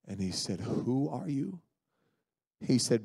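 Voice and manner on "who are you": slow, alarmed, a touch of horror. Acted. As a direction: ask it slowly, with dawning horror. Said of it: "horror and alarm came into the heart".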